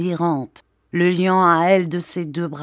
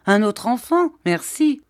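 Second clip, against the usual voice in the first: higher, mocking no thanks; sarcastic refusal; 6 semitones higher than usual. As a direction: toss it off with scorn. Husband mocking the idea.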